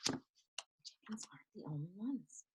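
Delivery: whispered book title. Acted. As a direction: whisper it mysteriously. Reads book title to kids.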